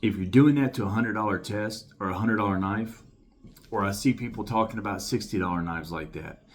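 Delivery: usual speaking voice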